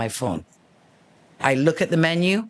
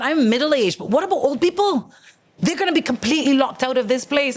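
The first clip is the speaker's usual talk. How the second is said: high indignant rant; 11 semitones higher than usual. Retold or imitated. Ranting to bartender.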